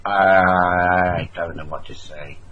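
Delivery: slow, low, lost voice; mock baffled local. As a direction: drawl it like a dim confused local. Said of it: "I just went".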